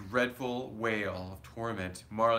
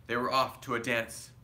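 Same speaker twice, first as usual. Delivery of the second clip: ordinary delivery, nothing marked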